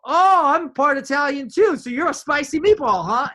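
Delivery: loud, high, hammed-up teasing; over-eager joking. Customer joking with an Italian waitress, over-eager and loud. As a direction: blurt the joke out big and high. Acts out his joke to waitress.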